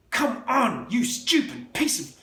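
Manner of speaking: high, strained curse at the wheel; grunting through effort; frustrated cursing. A narrator's reading.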